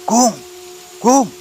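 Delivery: short, high urgent calls; shout. A narrator's reading. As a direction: yell his name to get attention. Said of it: "teriak Badri".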